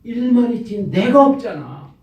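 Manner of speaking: the preacher repeating Jesus's rebuke to the congregation, forceful; emphatic repeat. Acted. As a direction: drive the point home, stressing 내가.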